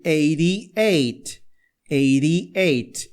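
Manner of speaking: eighty-eight with flapped Ts; tapped T. Shown as a demonstration. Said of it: "o T fica com som de R". (eighty-eight com T de R)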